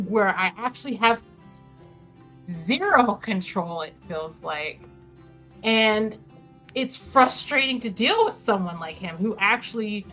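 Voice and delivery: usual speaking voice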